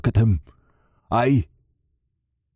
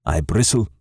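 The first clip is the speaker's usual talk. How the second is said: usual speaking voice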